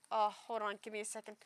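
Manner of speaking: normal talking voice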